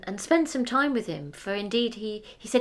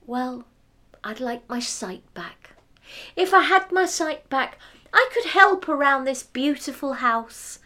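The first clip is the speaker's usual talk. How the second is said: lightly voiced old mother; 6 semitones higher, 5 dB louder than usual. Blind mother wishes.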